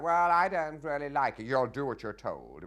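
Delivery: high, loud stagey line reading; stagey Kent lines. Retold or imitated. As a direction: ham it up a little as the nobleman. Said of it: "saying lines like".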